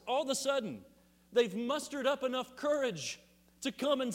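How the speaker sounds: high, loud, incredulous preaching; fiery preaching. Real, not acted. Pastor mocking the stolen-body story, rousing. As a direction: build the disbelief into a rousing challenge.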